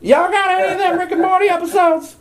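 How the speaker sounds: high, fast junkie whine; desperate addict voice. Retold or imitated. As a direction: beg like a fiending addict. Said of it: "a lot of people are just like".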